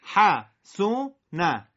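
حسونه split slowly into parts; very slow separated reading; slow syllable by syllable. Shown as a demonstration. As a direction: read حسونه apart, slowly.

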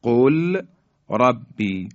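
distinct l and r in قل ربی; lam left unmerged. Shown as a demonstration. Tajweed teacher repeating the unmerged form, audible l in قل ربی. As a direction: slowly separate qul from rabbi in قل ربی. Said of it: "لام ساکن".

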